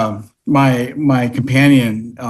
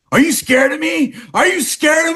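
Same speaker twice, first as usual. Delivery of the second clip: put-on taunting drunk; 11 semitones higher than usual. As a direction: yell it in his face. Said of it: "and the guy's like".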